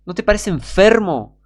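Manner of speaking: ordinary talk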